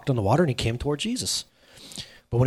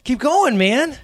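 higher, cheering him on; encouraging; 9 semitones higher, 3 dB louder than usual. Shown as a demonstration. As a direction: urge him to keep going, gently. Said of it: "That's the posture I read it".

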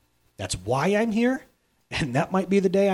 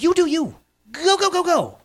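high, loud cheering encouragement; excited pep-talk cheer; 12 semitones higher, 6 dB louder than usual. Retold or imitated. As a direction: fire them up, go go go. Cheering people on to act.

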